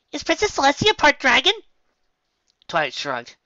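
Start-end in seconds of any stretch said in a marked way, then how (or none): 0.1-1.5 very high, loud excited squeal; excited Pinkie. A narrator's reading. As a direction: blurt it out as a hyper pony.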